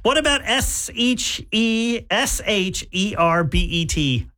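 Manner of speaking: letters spelled out slowly, one by one; spelling out. Shown as a demonstration. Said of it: "spelling the word out".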